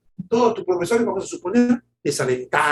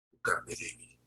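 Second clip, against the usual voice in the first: whispered; 14 dB quieter than usual. Acted. Verse repeated to each member.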